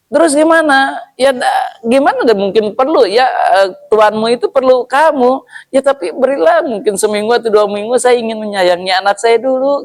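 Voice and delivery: high, pleading voice of a camel with a newborn; pleading camel mother. Acted. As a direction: plead for your calf, high and fast. Camel answering the Prophet. (unta betina memohon dengan suara tinggi)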